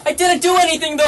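real high-pitched protest; heated denial. Real, not acted. Suspect to detectives accusing him, heated denial.

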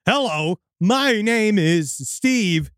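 put-on stilted stranger in a weird accent, very drawn out; never-spoken-before weirdness. Pale stranger introduces himself to a store clerk, stilted weird accent. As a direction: talk slowly, as if words are new to you. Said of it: "he speaks like he's never spoken before in a weird accent".

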